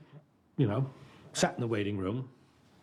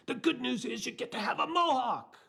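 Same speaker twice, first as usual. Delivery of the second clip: much higher, put-on English director voice; mock English director; 14 semitones higher than usual. Director approving the actor, high mock English voice. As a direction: squeak it like the eccentric director. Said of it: "He says".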